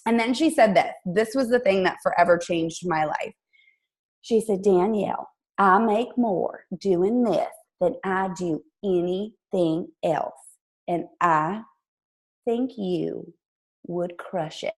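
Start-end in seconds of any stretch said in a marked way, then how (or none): 4.6-10.4 put-on slow, deliberate Southern accent; sweet Southern voice, drawn out slowly; Jackie Woodfin. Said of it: "I'm gonna do her accent". 10.9-14.7 put-on hushed, halting Southern accent; sweet Southern voice, trailing down softly; Jackie Woodfin. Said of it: "I'm gonna do her accent".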